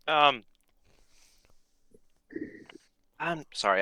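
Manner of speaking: talking through a mouthful; mouth full. Real, not acted. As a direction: speak round a bite of chips. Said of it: "I had, uh, guacamole and, and, uh, chips in my mouth".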